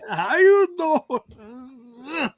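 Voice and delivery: very slow, drawn-out mock Italian-American "How you doing?"; Italian pickup line. Podcaster echoing his co-host's Italian pickup line, slow mock accent. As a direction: stretch it out like a lazy charmer.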